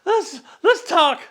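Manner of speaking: high-pitched laughing, gasping words; can barely talk for laughing; laughing hard. Real, not acted. Helpless laughing fit at own joke.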